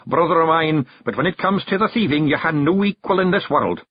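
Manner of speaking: commanding Scots chief; Rob Anybody. Acted. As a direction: give the raid orders. Rob gives orders.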